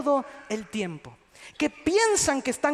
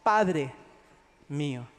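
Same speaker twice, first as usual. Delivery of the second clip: tender appeal; 9 semitones lower, slower than usual. Retold or imitated. David addressing Saul, in a low, gentle voice. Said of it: "No, le dijo".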